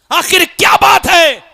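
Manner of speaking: loud, high-pitched exhortation; shouted sermon, pitched high and driven hard; full-force preaching. Real, not acted. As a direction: thunder a rebuke at the congregation.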